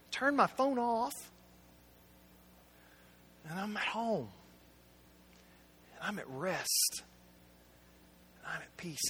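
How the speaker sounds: a preacher easing into quiet peace; calm and hushed. Acted. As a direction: let each phrase sink into rest. Describing rest at home, hushed.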